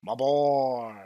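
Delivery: lightly voiced cartoon king; a hint of a hammy old king. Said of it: "king from the Zelda C D I games".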